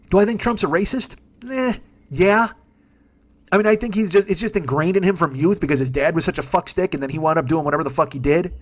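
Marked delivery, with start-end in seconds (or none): none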